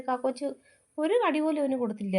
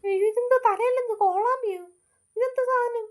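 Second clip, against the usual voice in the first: frightened cry; 11 semitones higher, 3 dB louder than usual. Acted. Mother sees scary siren monster.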